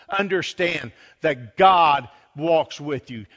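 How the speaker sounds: sermon building to a loud, high climax; impassioned preaching. Real, not acted. Preacher assuring congregation Jesus is always present, impassioned preaching. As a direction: drive each phrase home, rising steadily in force.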